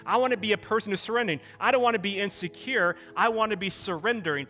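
impassioned preacher's plea to God; fervent declared prayer. Acted. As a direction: declare it to God in insistent, parallel phrases.

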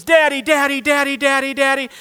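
high, urgent cries for Daddy; imitated screaming child. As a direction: act out a frightened little girl calling for her father. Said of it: "screaming at the top of her lungs".

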